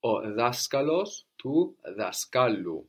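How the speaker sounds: daskalos stressed on DA, then daskalu stressed on KA, slowly; slow model contrasting DA and KA; teacher showing the accent moving forward; stress shift demo. Shown as a demonstration. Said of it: "It gets closer to the end".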